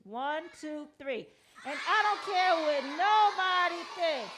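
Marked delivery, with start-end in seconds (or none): 1.7-4.3 loud, high shouted chant; outside voice. Real, not acted. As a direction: shout it out proudly. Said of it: "use the outside voice".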